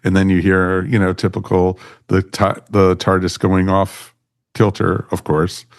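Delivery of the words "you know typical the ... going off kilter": ordinary talk, nothing marked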